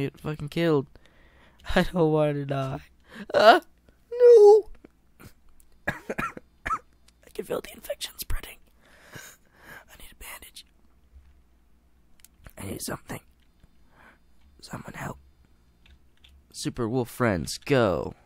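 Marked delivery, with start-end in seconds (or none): 3.3-4.7 very high, loud drawn-out no; hammed-up despairing no; mock scream. About to die in game. 5.9-15.2 faint breathless whisper; mock deathbed whisper; dying whisper. Dying character plea.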